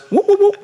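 high yelping whoop, whoop; Three Stooges whoop. Retold or imitated. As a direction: yelp it like a slapstick comic. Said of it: "the one who goes".

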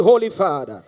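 ordinary delivery

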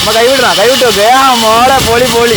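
loud, high encouraging cheers; cheering shout. Real, not acted. Son cheering mother hands-free on the cycle, shouting. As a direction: urge her on at full voice.

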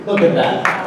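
ordinary talk

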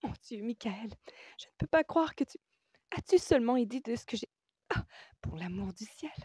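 high, breathy, flustered outburst; agitated. A narrator's reading. Woman no longer feigning calm with patient, high agitated outburst. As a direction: break off mid-sentence, overwhelmed, ending in exasperation. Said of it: "renonçant à feindre un calme qu'elle n'éprouvait pas".

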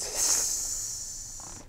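long, slow breathy hiss; snake hiss. Shown as a demonstration. Teacher showing the children the snake's sound, slow hiss. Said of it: "and it goes".

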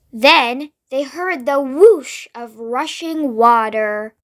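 ordinary delivery, nothing marked

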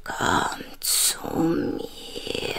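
soft, far-off unvoiced call of a name; a hollow whisper from the dark; a faint ghostly voice calling the name; eerie creature whisper. The creature's voice lures him.

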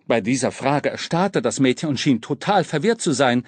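usual speaking voice

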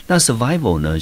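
normal talking voice

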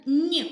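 loud, clear «н» sound; isolated sound. Shown as a demonstration. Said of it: "Перший звук".

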